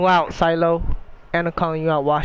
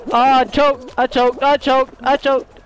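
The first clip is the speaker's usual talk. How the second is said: high, loud, repeated self-reproach; agitated lament; 6 semitones higher, 6 dB louder than usual. Real, not acted. Player sure he has choked the round, agitated lament. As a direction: wail it over and over in frustration. Lamenting his failure in game.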